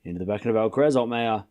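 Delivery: low, quiet tennis commentary; live play-by-play. Acted. Calling the tennis rally for stream viewers, hushed commentator. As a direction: call each shot like a TV commentator.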